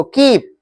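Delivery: कीप with a long, dragged ee; drawn-out vowel. Shown as a demonstration. Teacher has pupils repeat, कीप with a long vowel. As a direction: drag out the ee of कीप. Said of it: "घसीटना उसको".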